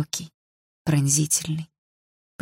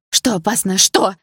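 louder, alarmed outcry; cried out; 3 semitones higher, 5 dB louder than usual. A narrator's reading. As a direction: shout the question at him. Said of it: "выкрикнула я".

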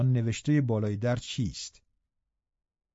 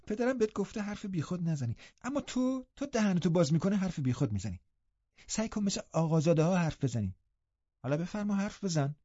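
higher, softer sneering voice; mocking lecture; 6 semitones higher, 5 dB quieter than usual. Linton lectures Hareton mockingly, high and soft. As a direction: talk down to him.

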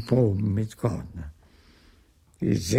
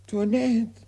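raised, higher call for the maid; 11 semitones higher than usual. Acted. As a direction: call Toinette across the house. Argan calling his maid.

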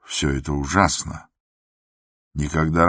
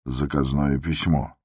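ordinary delivery

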